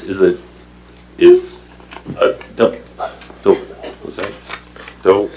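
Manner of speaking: faltering, stuck on the word; choked up. Real, not acted. Commissioner losing his words before the audience, choked up. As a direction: stumble and break off, voice dropping away. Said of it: "I'm kinda choked up there".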